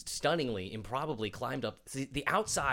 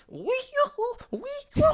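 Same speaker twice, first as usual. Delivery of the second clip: high, squeaky mock cackle; hammed-up evil laugh, very high; bad cackle impression; 7 dB louder, much slower than usual. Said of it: "My voice is a little".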